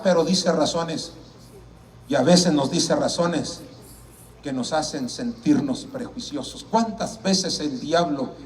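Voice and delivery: normal talking voice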